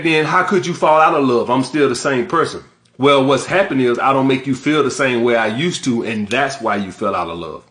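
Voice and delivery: usual speaking voice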